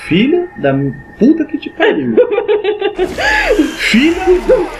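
shrieking laugh in panic; scream-laugh at a jump scare; panicking gamer. Real, not acted.

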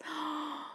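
sharp soft intake of breath in delight; delighted gasp. Real, not acted. Opening glitter pots for viewers, delighted gasp.

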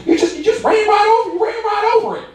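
high, hammed-up shrieking accusation; irate woman yelling. Woman accuses the driver beside her beat-up Chevy, mock shriek. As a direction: act out her outraged screech.

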